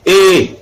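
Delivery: E held long and high, then falling. Shown as a demonstration. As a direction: draw out the vowel E. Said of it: "la formation de certaines voyelles longues".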